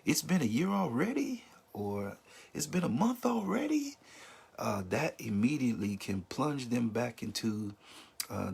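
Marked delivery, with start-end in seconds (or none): 0.1-1.4 high-pitched mock of a careless friend; a hint of a careless acquaintance; insensitive remark. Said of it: "don't say this". 2.5-4.0 high-pitched mock of a careless friend; a hint of a careless acquaintance; insensitive remark. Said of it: "don't say this".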